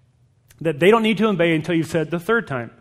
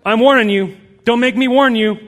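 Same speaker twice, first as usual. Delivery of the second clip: louder, higher mock warning; parental threat; 5 semitones higher, 7 dB louder than usual. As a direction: warn like a parent at the limit. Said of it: "make threats".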